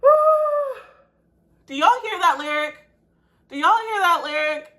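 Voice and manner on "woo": shaky high woo. Real, not acted. Recovering from tears, a loud high woo. Breath out after emotion.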